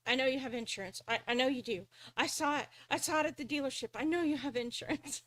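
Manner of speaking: freaking out. Retold or imitated. Car salesman to the accident victim, freaking out. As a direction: imitate a frantic salesman pleading. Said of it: "he's freaking out all right".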